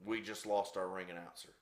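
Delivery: low, soft understated remark; quiet aside. Real, not acted. Storyteller noting the announcer has fled, quiet aside. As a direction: drop it in softly after the commotion.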